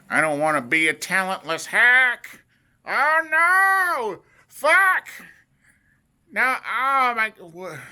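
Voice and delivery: high, loud escalating despairing wail; mock panic. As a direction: wail in hammed-up self-doubt. Acted panic about being talentless.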